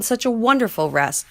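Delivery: ordinary delivery, nothing marked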